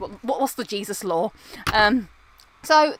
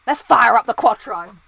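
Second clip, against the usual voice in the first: a lightly voiced gruff cop, a bit louder; Gene Hunt; 9 dB louder than usual. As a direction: quote it like a TV detective, mildly hammed. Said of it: "what's something that Gene Hunt would say".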